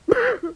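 bright high little spider laugh; high giggle. A narrator's reading. Spider laughing at the boy's sadness, giggling. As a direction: giggle with delight.

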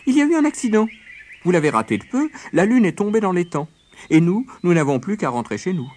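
high, animated rabbit voice; sly rabbit. A narrator's reading. Rabbit telling friends about the fallen moon, dramatically put-on. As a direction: break the news dramatically, pitched high and loud.

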